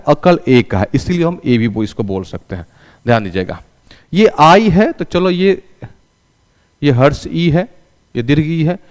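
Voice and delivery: ordinary talk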